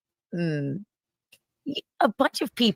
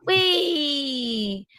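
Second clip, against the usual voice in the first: party voice; 5 semitones higher than usual. Real, not acted. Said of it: "I still have party voice".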